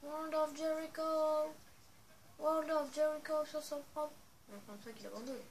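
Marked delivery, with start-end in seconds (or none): 0.0-4.1 high, loud, drawn-out announcer call; wrestling commentator. Pretend. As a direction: announce the finisher like ringside. Announcing finisher in game. (commentateur de catch qui annonce la prise)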